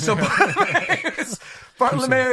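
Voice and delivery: can barely get the name out for laughing. Real, not acted. Podcast host laughing while recapping Bartolomeo.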